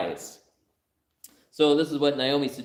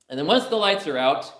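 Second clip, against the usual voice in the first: higher and higher, building to a loud squealing laugh; daughter's plan building; 9 semitones higher, 5 dB louder than usual. Acted. Daughter acting out her proposal, rising to a loud giggly squeal. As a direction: build from eager to a shrieking, giggling climax. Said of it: "He said".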